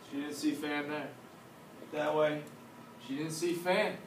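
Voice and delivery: plain reading voice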